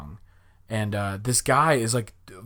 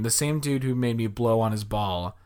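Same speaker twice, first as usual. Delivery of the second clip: usual speaking voice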